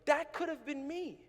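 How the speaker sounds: moved, high-pitched confession; emotional. Real, not acted. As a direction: voice rising high with feeling. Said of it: "I get emotional sometimes when I share this story".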